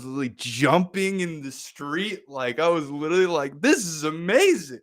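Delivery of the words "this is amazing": retold elation. Retelling his elation on the walk.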